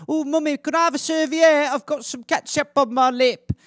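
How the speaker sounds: put-on high-pitched little British child; piping, hammed-up British kid asking politely; cute British accent. British child asking Mummy for a serviette, high cute accent. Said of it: "a cute little British accent".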